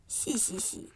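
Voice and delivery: breathy little snicker; sly snicker. Acted. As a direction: snicker slyly to yourself. Wolf snickers.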